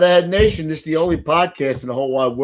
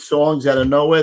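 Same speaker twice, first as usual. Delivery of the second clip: usual speaking voice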